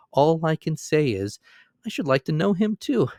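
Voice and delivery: ordinary delivery, nothing marked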